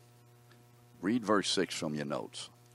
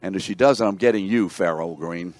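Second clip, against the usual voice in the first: much louder teasing threat; playful mock threat; 10 dB louder than usual. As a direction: boom it out as a joking warning. Playfully threatens a student by name.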